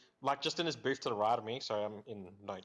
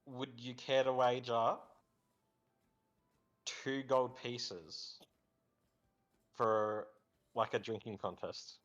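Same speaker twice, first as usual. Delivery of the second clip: slow, formal challenge; slower than usual. Acted. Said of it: "I'm gonna approach them and be like".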